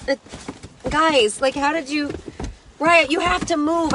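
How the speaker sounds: usual speaking voice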